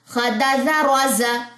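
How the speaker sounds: slow, sing-song syllables; rhythmic reading. Shown as a demonstration. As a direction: read it in one steady rhythm. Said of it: "लय में पढ़ेंगे".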